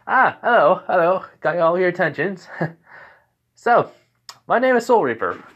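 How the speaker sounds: high, loud greeting to a crowd; public announcement. Acted. Soul Reaper greets the festival crowd, projecting high.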